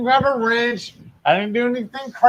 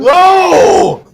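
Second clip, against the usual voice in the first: very high, very loud drawn-out no; loud shout; 12 semitones higher, 11 dB louder than usual. Real, not acted. Refusing a threatened rash, loud high shout. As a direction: bellow a long, high-pitched refusal. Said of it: "Wow, that was loud".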